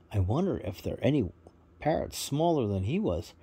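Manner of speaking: ordinary delivery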